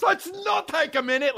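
high, curt mocking rebuff; mock heated actor. Comic retelling the actor refusing a break, high and curt. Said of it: "And he says".

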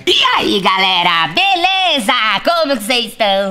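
high squeaky helium catchphrase greeting. Pretend. Friend performs his catchphrase to the audience in a squeaky high voice. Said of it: "eu vou fazer o bordão".

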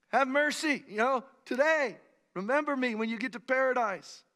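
loud, high-pitched plea; crying out. Acted. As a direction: cry out like a man begging for mercy. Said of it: "all he did was cry out".

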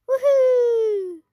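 loud joyful woohoo; excited woohoo. Real, not acted. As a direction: yell it with glee down the slide. Going down the water slide.

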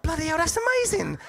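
high, loud and laughing; excited. Retold or imitated. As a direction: marvel at it, voice shooting up. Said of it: "I'm like".

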